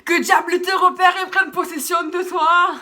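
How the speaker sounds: slow, high, loud laughing witch curse; mock curse. Girl jokingly cursing her friend, laughing mock-witch shout. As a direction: cast the spell like a cackling sorceress.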